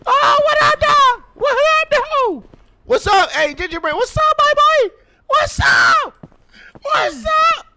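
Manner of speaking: high, loud excited greetings with laughs; fanboy shouting at Gingerbread Man; hyped shouting. Real, not acted. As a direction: yell hello like meeting a celebrity.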